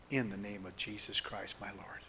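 soft whisper, voice dropping away; whispered prayer ending. Acted. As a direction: hush it into the amen.

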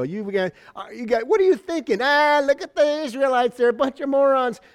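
mock the marchers like a heckler on the wall; mocking enemy. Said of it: "throwing pop cans and hitting them in the head and go".

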